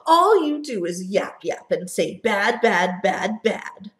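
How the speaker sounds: loud, angry retort; Onceler yelling. Acted. Onceler yelling back at the Lorax, loud and angry. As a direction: shout him down in fury. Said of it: "I yelled at the Lorax".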